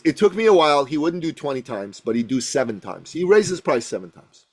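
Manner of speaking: ordinary talk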